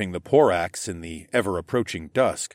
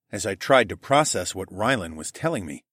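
ordinary delivery

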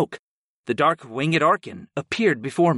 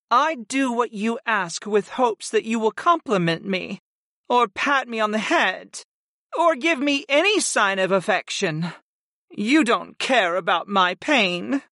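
high-pitched, wounded devotion; Sarah, hurt; 8 semitones higher than usual. A narrator's reading. Neglected servant reproaching her master for no affection, hurt. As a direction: plead for affection in the goddess's higher voice.

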